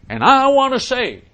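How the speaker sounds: loud, high, drawn-out orator; hammed-up mock preacher oratory; Martin Luther King. Host imitates a speech heard in childhood, booming mock orator. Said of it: "you'd hear Martin Luther King say".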